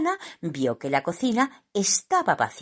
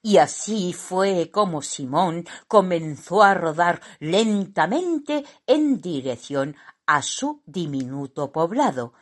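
ordinary delivery, nothing marked

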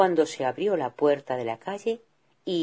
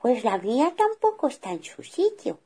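a touch of mother bear; 8 semitones higher than usual. Acted.

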